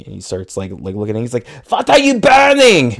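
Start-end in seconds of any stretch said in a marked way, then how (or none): 1.7-2.9 put-on high, loud yell in German accent; comic German-accented screech, far louder and higher; Adolf yelling. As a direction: holler it, hammed-up German accent. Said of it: "Just yelling".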